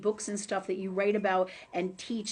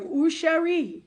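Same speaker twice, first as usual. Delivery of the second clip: mock French flirt; 7 semitones higher, 5 dB louder, slower than usual. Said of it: "they're going".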